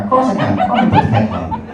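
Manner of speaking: high-pitched mock-friendly local; hammed-up helpful passer-by; welcoming Irish local. Local answering a lost driver.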